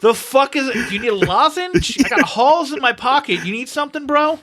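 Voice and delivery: lightly hammed-up disbelief; mock bafflement. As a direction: act out a baffled listener offering help. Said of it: "And someone's like".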